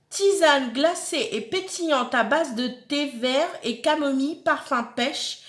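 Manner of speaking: slow, deliberate product reading; label read-out. Acted. Reading an iced tea label to viewers, slow label read-out. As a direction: spell out the label with drawn-out words. Reads drink label aloud.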